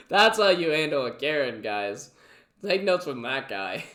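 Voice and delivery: ordinary talk, nothing marked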